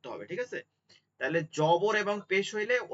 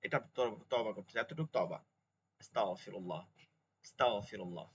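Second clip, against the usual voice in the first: soft, low, murmured আস্তাগফিরুল্লাহ; softly under the breath; 7 semitones lower, 7 dB quieter than usual. Shown as a demonstration. Teacher showing the everyday quiet repentance, said softly. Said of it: "সাধারণত এরকম পড়বো".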